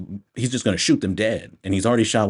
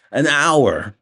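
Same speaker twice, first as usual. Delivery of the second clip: AN HOUR, louder and higher in disbelief; shocked exclamation; 6 semitones higher, 5 dB louder than usual. Real, not acted. Reviewer shocked by an hour of movie left, exclaiming.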